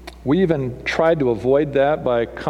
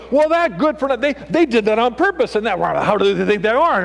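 mock angry driver; 9 semitones higher than usual. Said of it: "and we go".

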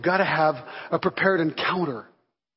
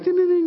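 high sing-song ding ding ding like a shop bell; bell ding imitation; 11 semitones higher, 4 dB louder than usual. Pastor mimics the bakery door bell, high sing-song chime.

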